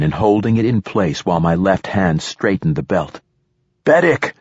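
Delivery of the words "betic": high, urgent call; pitched-up scream, short of full volume; screamed name. A narrator's reading. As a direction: call out sharply to someone in danger. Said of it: "I screamed".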